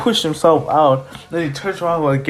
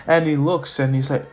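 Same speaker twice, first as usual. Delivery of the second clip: ordinary delivery, nothing marked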